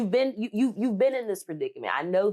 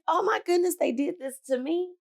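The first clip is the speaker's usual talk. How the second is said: high, hammed-up wail of hurt; mock despair; 12 semitones higher than usual. She voices a listener passed over for promotion, in high mock despair. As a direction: play the wounded employee, over the top.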